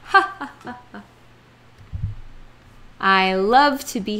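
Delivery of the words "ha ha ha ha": playful "ha ha" laugh, fading away; demonstrated laugh. Shown as a demonstration. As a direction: laugh it out to show happiness, then trail off. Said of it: "I laugh".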